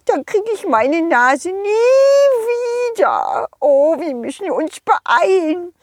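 high, worried snowman voice, slowing at the end; a little snowman afraid to lose his nose; panicky snowman. Acted. Snowman panics about the bridge. (hohe, ängstliche Schneemannstimme)

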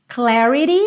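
"Clarity" as "clari-dee" with a flapped t; tapped T. Shown as a demonstration. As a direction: soften the t of "Clarity" to a quick tap. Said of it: "com o rarará no T".

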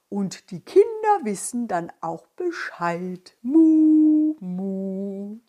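slow cow voice ending in moos; a slow cow mooing its words. Acted.

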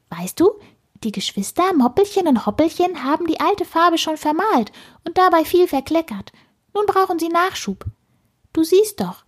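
high, chattering little squirrel; squeaky voice. A narrator's reading. Squirrel explains where the bunny children went, squeaky. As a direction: explain busily in a piping voice. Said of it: "piepste es in die Stille hinein".

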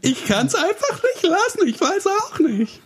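put-on very high, slow childish squeak; Infantino voice. Teasing a friend, as a squeaky childish character. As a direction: play a naughty cartoon kid who can't help it.

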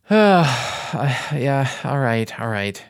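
big exasperated sigh, then giving in; imitating a reluctant official caving; grudging surrender. Official finally gives in grudgingly.

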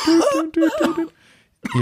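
high beeping phone tone; dial tone beeps. Pretend. Telefontöne nachgemacht. (Tuten wie ein Telefon)

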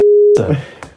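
loud laugh cutting off the sentence; burst of laughter. Real, not acted. Telling a friend about the absurd examiner, loud laugh. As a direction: laugh instead of the insult.

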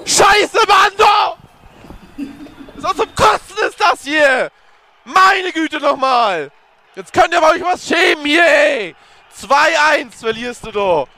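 angry shouting. Real, not acted. Played clip of angry fan commentary.

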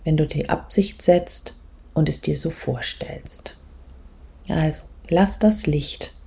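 soft, calm meditation voice, often whispered; guided meditation. Acted. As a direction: guide the listener gently inward.

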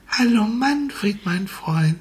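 hohe, langsame Blumenstimme; Sonnenblume spricht. Acted. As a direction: freundlich flüsternde Blume. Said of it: "lächelt und sagt". (Sonnenblume begrüßt Manfred freundlich, hoch und langsam)

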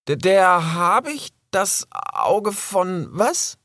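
very high, slow, stammering cub; ask, puzzled and a little scared; young fox asking what the raven means; confused young fox. Acted. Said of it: "fragte Rix".

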